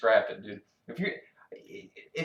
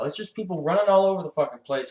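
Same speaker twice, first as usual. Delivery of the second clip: normal talking voice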